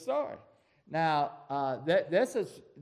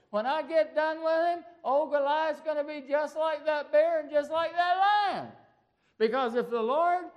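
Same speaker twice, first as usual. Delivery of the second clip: put-on high boyish voice growing louder and bolder; a shepherd boy boasting of the bear and lion, building to a louder finish; young David; 11 semitones higher, 3 dB louder than usual. David assuring Saul he can beat Goliath, high voice building louder. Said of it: "David said".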